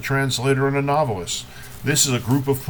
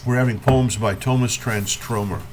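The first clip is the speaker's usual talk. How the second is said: normal talking voice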